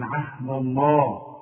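الله with thick emphatic ل; slow, darken the لام in الله; heavy Allah. Shown as a demonstration. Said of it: "نفخم لفظ الجلالة". (تفخيم لفظ الجلالة)

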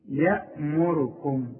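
يأمركم very slow, syllable by syllable; measured, stretched يأمركم; careful recitation. Shown as a demonstration. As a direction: draw out يأمركم with a thin "ya". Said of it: "أنا هعمل إيه".

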